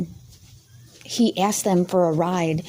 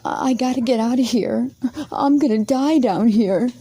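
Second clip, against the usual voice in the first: mock scared plea; 5 semitones higher than usual. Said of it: "and says".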